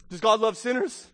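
ordinary talk, nothing marked

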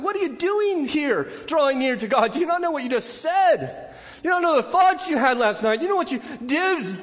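a hint of a taunting inner accuser; accusing conscience. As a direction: needle yourself like a nagging conscience. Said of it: "you will hear that voice".

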